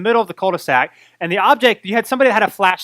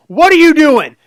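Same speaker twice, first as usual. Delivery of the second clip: loud, higher demand; stern shout; 8 semitones higher, 10 dB louder than usual. Retold or imitated. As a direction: confront the kid, shouting. Neighbour confronts him with knife.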